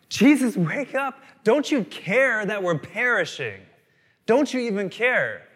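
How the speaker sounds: high, loud panicked plea; freaking out. Acted. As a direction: cry out to wake someone in a storm. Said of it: "the disciples are freaking out".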